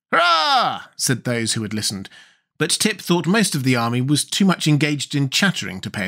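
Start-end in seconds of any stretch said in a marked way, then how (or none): 0.1-0.8 cheer. A narrator's reading. The army cheers.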